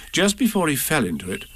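plain reading voice

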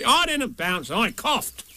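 put-out protest; cross Tigger. Acted. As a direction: cut in crossly to deny it. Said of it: "said Tigger crossly".

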